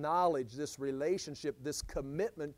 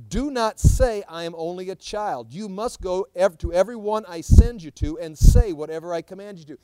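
louder, emphatic rebuke; firm command; 13 dB louder than usual. Retold or imitated. God correcting Jeremiah's excuse, loud and firm. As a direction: lay down the law, strong and loud.

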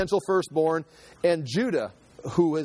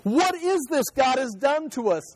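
much higher, frightened cry to heaven; trembling fear; 9 semitones higher than usual. A narrator's reading. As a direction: plead it as scared men who feel punished. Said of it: "turned to each other trembling".

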